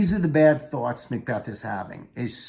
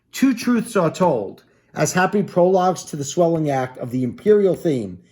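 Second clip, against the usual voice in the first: dramatic aside; 3 semitones higher, 6 dB louder than usual. Acted. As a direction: savour the line like a stage aside. Reads Macbeth's aside aloud.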